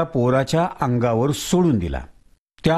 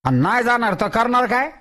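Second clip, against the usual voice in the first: higher, indignant challenge; angry Tatya; 8 semitones higher than usual. Acted. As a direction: demand it angrily. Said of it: "तात्या रागवून म्हणाला".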